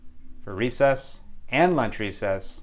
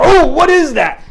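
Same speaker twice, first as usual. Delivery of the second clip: very high, loud startled yell; alarmed cry; 12 dB louder than usual. Retold or imitated. Boy feeling weight jump on his back, alarmed. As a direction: cry out as if something landed on you.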